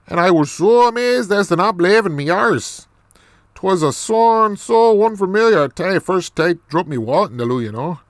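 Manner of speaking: lilting, animated mock Irish brogue; Irish accent. Storyteller amazed at what is in the loo, Irish accent. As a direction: play it up in a broad put-on Irish accent. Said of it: "more of this Irish accent".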